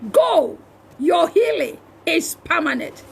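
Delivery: ordinary talk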